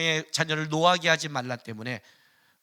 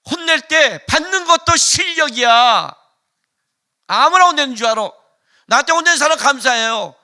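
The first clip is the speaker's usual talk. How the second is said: loud, high, fervent preaching; fiery pulpit preaching; preaching at full force; 8 semitones higher, 10 dB louder than usual. Acted. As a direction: thunder it at the congregation.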